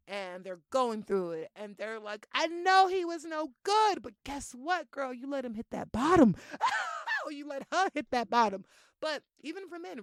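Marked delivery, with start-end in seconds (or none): 2.3-6.4 put-on higher, louder sassy girlfriend voice; loud, high mock gossip about a friend's bad man; hammed-up girlfriend gossip. Voicing friend's dramatic commentary. 6.4-7.2 shrieking giggle at her own joke; high laugh. Real, not acted.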